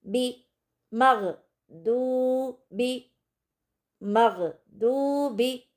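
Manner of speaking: दूबी with दू thick and full, slow syllables; heavy dad drill. Shown as a demonstration. Teacher drills दू and बी for students, heavy dad. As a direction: hit दू heavy and thick, then a light बी. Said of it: "दौद को बहुत मोटा और ऊंचा पढ़ेंगे".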